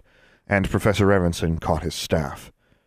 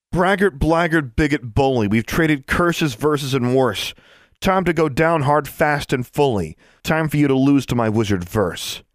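put-on girl's defiant verse; 7 semitones higher than usual. A narrator's reading. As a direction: fling the rhyme as a challenge. Alexandra's rhyming challenge.

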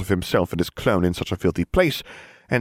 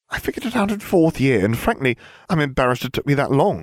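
put-on high-pitched girl's voice; high, matter-of-fact character voice; know-it-all Hermione; 8 semitones higher than usual. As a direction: explain it briskly as the clever girl, pitched up.

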